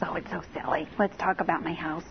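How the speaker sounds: bashful. Retold or imitated. Client shyly drops her romance wish for house talk, bashful. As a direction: imitate a blushing woman, low and hushed. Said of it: "And then she blushed".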